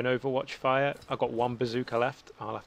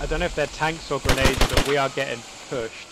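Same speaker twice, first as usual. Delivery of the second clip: urgent shout; 7 dB louder than usual. Real, not acted. Under attack, calling out.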